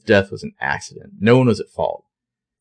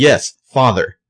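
loud bratty reply, higher; hammed-up bratty daughter; 5 semitones higher, 7 dB louder, slower than usual. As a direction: snap back like a sulky teen. Daughter answers father, recap.

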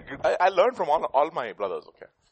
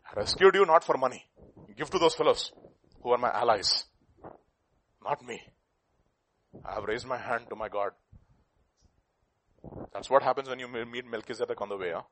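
ordinary talk, nothing marked

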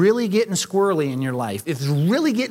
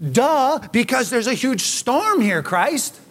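exasperated retort; 5 semitones higher, 4 dB louder, slower than usual. Pretend. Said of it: "I'd slap my forehead and say".

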